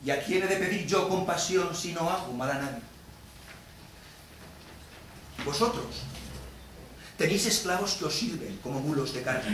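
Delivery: quiet, controlled accusation; subdued reproach. Acted. Moneylender confronts slave owners with their slaves, subdued reproach. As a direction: reason softly with the court. Subdued argument after the outburst.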